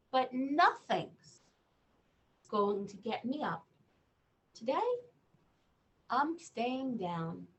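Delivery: lightly voiced stubborn boy reading; reading to children. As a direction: read the boy's refusal to a child. Reads picture book aloud.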